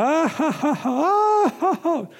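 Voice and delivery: high-pitched put-on laughter; forced laugh. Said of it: "I just started laughing".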